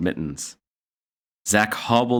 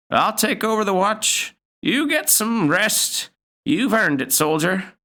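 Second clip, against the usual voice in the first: put-on slow, high old-lady voice giving orders; a batty gran taking over the watch; high old granny playing sentry; 14 semitones higher, slower than usual. Grandmother sending Zack to rest like a soldier, slow high old-lady voice.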